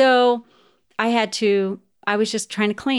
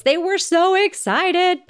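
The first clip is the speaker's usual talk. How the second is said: high, drawn-out gush, a bit louder; excited kids; 11 semitones higher, 4 dB louder, slower than usual. Retold or imitated. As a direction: gush it like thrilled kids, stretching it out. Recalls the kids' excitement.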